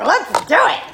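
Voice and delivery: high, loud gung-ho cry; hammed-up burst of eagerness; wildly eager. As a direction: act out raring to go, played up. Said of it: "so anxious to do step four".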